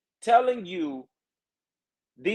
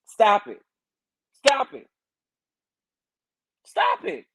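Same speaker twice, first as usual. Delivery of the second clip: each repeat higher, with pauses between; exasperated scolding; 7 semitones higher than usual. Real, not acted. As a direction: scold them, climbing higher on every repeat.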